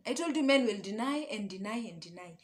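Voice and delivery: ordinary talk, nothing marked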